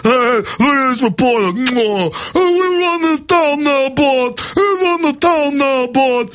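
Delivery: cartoon southern cop gloating; mock goon cop. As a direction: sneer at the reporter like a bully. Mocking goon cops at reporter.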